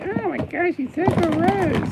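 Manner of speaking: very high, slow whiny voice. Pretend. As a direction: whine it out, high and drawn out. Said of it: "I sound like a whiny".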